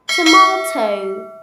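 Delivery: slow loud British to-mah-to; British accent. Shown as a demonstration. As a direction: say tomato the British way, slowly. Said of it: "Say with me".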